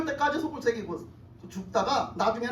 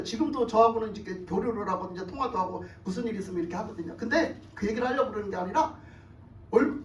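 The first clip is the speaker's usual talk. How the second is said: ordinary talk, nothing marked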